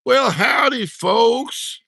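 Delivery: high, loud showman's howdy; signature howdy greeting. Acted. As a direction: holler it out as a signature catchphrase. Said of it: "Got my signature thing there".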